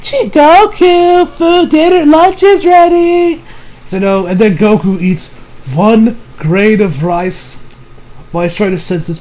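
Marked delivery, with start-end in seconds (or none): 0.0-3.4 very high, slow calling voice; Chi-Chi calling. Retold or imitated. Wife calling Goku to dinner, high and drawn out. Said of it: "And then Chi-Chi's being like".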